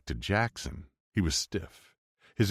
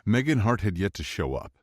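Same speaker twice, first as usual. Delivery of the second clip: ordinary delivery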